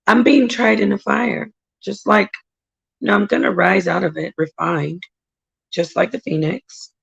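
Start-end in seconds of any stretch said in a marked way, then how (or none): none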